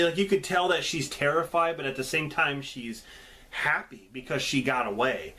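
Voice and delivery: ordinary delivery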